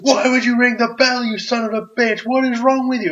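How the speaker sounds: high, loud furious yelling at the ref; mock angry Bubba. Wrestler yelling at the referee for ringing the bell, angry. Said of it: "Bubba's angry".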